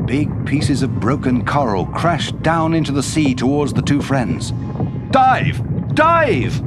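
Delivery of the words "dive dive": high, loud urgent yell; yelled command. A narrator's reading. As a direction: yell the order to dive, high-pitched. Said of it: "yelled U K".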